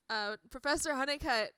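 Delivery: normal talking voice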